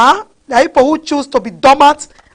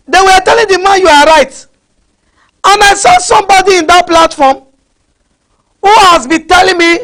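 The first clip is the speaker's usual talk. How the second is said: higher, louder preaching climax; rising preach; 6 semitones higher, 5 dB louder than usual. Real, not acted. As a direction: build it up to the pulpit climax.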